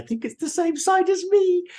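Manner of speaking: teasing high voice. Real, not acted. Siding with his cohost, teasing high voice. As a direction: jump up high on the words.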